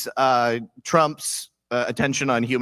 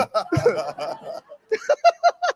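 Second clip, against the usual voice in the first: very high shrieking laugh. Real, not acted. Guest laughing hard at her own story, shrieking.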